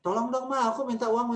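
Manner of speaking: put-on child begging mom in a high voice; pleading child. Shown as a demonstration. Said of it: "orang yang lemah lembut tuh bisa tegas".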